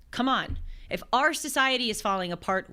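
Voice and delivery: ordinary talk, nothing marked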